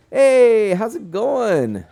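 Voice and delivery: high, loud, drawn-out hello; excited greeting. Real, not acted. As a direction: call out a big happy welcome. Greets viewer Red on stream.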